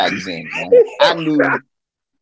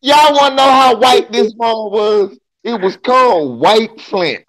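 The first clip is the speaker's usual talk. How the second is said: louder, high, mocking build-up; excited mockery; 8 semitones higher, 4 dB louder than usual. Real, not acted. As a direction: hype up the punchline.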